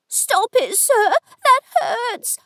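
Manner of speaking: high-pitched cry of a woman being hurt; pained protest. A narrator's reading. Woman begs the lord to stop hurting her, pained protest.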